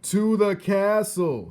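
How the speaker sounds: high, loud and slow shout; loud, slow, drawn-out shout. A narrator's reading. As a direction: spell it out loudly to a deaf girl. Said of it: "shouted the soldier".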